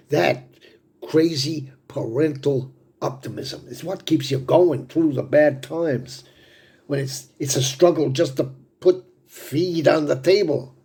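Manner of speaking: impassioned defense. Acted. Father defending parental optimism in hard times, impassioned. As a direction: press the point with rising fervor.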